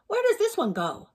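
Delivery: a bright, loud puzzled query; big skeleton. Acted. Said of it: "said the big skeleton".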